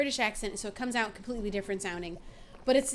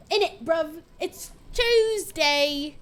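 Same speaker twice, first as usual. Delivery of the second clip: loud, higher, hammed-up British accent; god-awful British accent; 4 semitones higher, 7 dB louder, much slower than usual. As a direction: do a terrible British accent, dragging out Tuesday. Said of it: "absolute most god-awful British accent".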